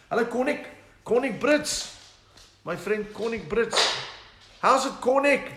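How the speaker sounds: high, loud, excited hello to a friend; greeting a friend watching the stream, high and loud. Real, not acted. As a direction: call out his name with delight.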